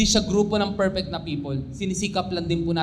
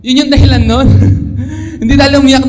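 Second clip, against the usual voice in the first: laughing; 5 semitones higher, 12 dB louder, faster than usual. Real, not acted. Laughing at the misunderstanding.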